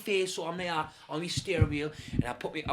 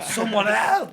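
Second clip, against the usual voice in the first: high, loud, comic plea; mock cry for help; 7 semitones higher, 10 dB louder, much slower than usual. Trapped driver signalling passers-by, mock-desperate cry. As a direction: call out in exaggerated desperation.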